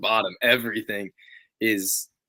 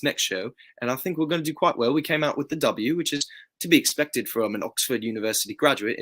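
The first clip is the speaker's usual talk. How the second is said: put-on British character voice, lilting; hammed-up British wrestler persona; British accent; 3 semitones higher than usual. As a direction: play an Oxford graduate with a British accent. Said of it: "work on my British accent".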